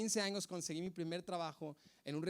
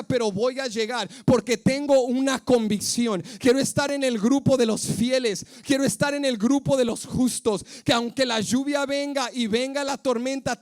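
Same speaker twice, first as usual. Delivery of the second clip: much louder, crying out to God, building to a shout; impassioned prayer; 6 semitones higher, 13 dB louder than usual. Acted. Impassioned prayer at full force.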